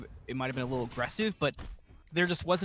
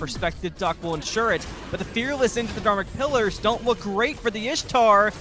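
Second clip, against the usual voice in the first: excited play-by-play, climbing louder; fighting game announcer; 6 semitones higher, 6 dB louder than usual. Real, not acted. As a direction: build the call to a peak. Live play-by-play hype builds.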